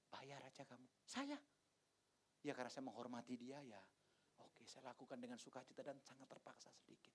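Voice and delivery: soft, grudging under-the-breath reply; hushed aside. Retold or imitated. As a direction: mutter it as if the wife mustn't hear. Pastor retelling paying reluctantly himself. (berbisik dengan setengah hati)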